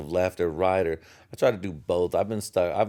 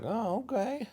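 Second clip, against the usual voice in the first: mock surprise; 6 dB quieter than usual. Mock reaction to a news show.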